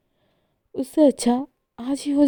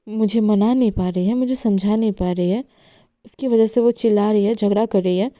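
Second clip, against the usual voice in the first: angry outburst; 4 dB louder than usual. Acted. Esha quarrels, wants breakup.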